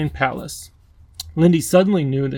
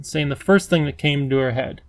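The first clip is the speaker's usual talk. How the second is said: usual speaking voice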